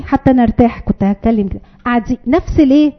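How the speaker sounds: normal talking voice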